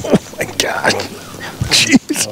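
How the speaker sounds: a high, stunned gasp of a word; amazed jeez. Real, not acted. Angler sees a giant buffalo fish, high amazed exclamation. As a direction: caught off guard by the size of it. Huge fish surfaces beside boat.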